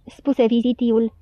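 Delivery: ordinary delivery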